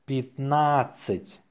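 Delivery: slow, drawn-out dictation. Shown as a demonstration. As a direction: say it slowly for learners. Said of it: "say it slowly".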